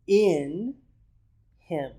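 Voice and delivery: "in" drawn out high and loud, then a pause before "him"; "in" lifted and held, set apart from "him"; stress on IN. Shown as a demonstration. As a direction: punch and stretch "in", then land "him" separately. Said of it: "even when you emphasize".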